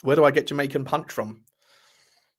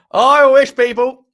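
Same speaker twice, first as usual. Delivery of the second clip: loud joking wish; 9 semitones higher, 13 dB louder, slower than usual. Real, not acted. Joking about being well endowed.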